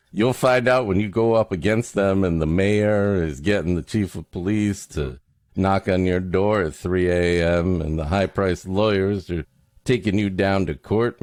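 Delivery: ordinary talk, nothing marked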